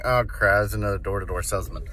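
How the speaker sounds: low, fast grumble to oneself; dismissive low aside; weary inner grumble. Acted. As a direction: think it to yourself, annoyed and low. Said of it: "in their mind say".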